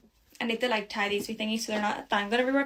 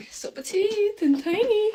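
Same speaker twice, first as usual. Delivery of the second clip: cutesy; 10 semitones higher, slower than usual. Real, not acted. Admiring her legs in mirror.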